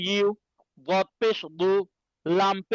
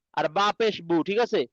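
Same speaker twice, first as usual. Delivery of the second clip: ordinary delivery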